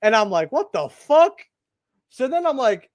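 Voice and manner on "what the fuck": high, loud reenacted shock; retold disbelief. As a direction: act out being stunned and indignant. Retold reaction to being hit.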